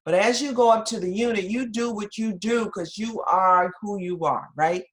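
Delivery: ordinary talk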